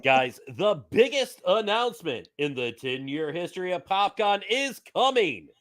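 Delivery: big hammed-up show announcer; cheesy announcer voice. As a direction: read it like a promo, punching the key words. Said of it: "announcer voice".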